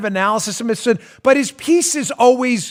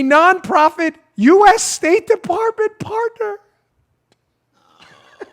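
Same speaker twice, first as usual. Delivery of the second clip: breathless, wheezing laughter through the words; real laughter, barely getting words out; laughing to tears; 9 semitones higher than usual. Real, not acted. Host cracks up over the spy story, laughing helplessly. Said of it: "like, crying".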